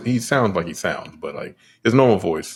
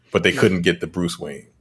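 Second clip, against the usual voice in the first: ordinary delivery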